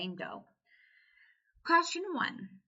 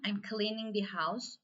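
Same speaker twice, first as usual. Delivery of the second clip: ordinary delivery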